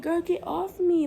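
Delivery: high, strained mock-winded demand; out of breath. Telling the girl on top to get off, mock out of breath. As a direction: push it out as if winded. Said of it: "out of breath".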